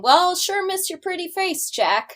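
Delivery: high-pitched mocking retort; mocking parroting. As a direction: throw it back at him in a singsong imitation. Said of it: "she just parrots back to him".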